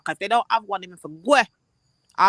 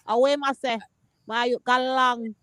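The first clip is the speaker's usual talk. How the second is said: Jamaican patois; 3 dB louder, slower than usual. Retold or imitated. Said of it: "the Jamaican come out".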